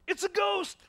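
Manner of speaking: high, loud panicked shout; terrified cry. Acted. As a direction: scream it like you've seen a ghost. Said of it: "they cried out".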